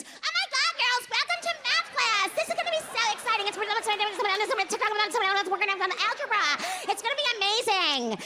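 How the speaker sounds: very high mock girly teacher voice; gushing math teacher. Teacher welcomes girls to math class, mock gushing girly voice. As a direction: squeal with exaggerated excitement, dissolving into babble. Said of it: "walk into math class".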